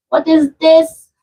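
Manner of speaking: usual speaking voice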